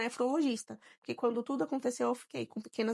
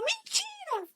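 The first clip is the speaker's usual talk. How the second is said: very high shocked "Mentira!"; astonished friend; much slower than usual. Retold or imitated. Friend hearing the pregnancy news, shrieking disbelief. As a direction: squeal it in disbelief.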